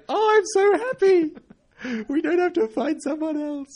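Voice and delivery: beam like relieved producers; gushing producers. Retold or imitated. Producers beaming at the host after the take, high and gushing. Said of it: "they're like".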